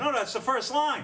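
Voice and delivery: high-pitched mock yell. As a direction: yell it in irritation, half laughing. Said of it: "He's yelling".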